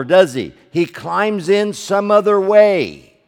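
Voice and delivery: loud, ringing preaching to the congregation; preaching at full force. Acted. Pastor preaching to his congregation, loud and ringing. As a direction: proclaim it out over the room. Preacher driving point home.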